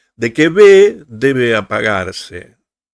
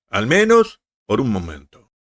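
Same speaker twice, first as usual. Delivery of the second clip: usual speaking voice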